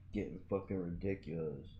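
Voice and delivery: very soft grumble to yourself; hushed afterthought; muttered aside. Real, not acted. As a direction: mumble it under your breath. Muttered repeat to himself.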